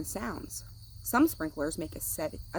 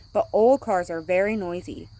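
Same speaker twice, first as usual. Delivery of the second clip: usual speaking voice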